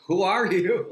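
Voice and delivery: high, loud excited burst; a breathless fan rushing up to her. Retold or imitated. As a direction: blurt it out, thrilled and amazed. Running up to her, amazed.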